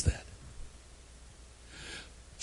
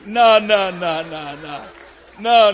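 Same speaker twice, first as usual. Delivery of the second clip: normal talking voice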